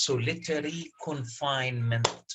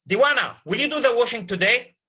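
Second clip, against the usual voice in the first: bossy madam; 7 semitones higher, 6 dB louder, faster than usual. Retold or imitated. Teacher voices the madam giving orders.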